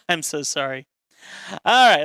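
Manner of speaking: ordinary delivery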